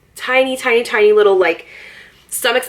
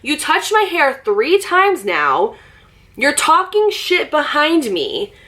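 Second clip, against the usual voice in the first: slow, higher and deliberate; slow, pointed; 6 semitones higher, slower than usual. Retold or imitated. Confronting the girl about touching her hair, slow and pointed. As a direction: lay out each charge slowly. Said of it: "I said".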